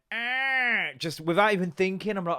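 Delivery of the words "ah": squeaky door imitation. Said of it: "so she went".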